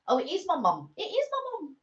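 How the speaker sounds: high-pitched call, away from the mic; rising to a high, distant squeak; real excited realisation. Real, not acted. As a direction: pipe it up high as mum turns up. Calling out on seeing mum arrive.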